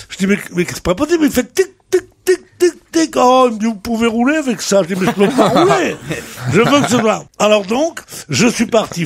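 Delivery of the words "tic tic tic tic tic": high, clipped tic tic of the broken mirror; imitating the mirror's ticking noise; ticking noise imitation. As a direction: mimic the faulty mirror's ticking.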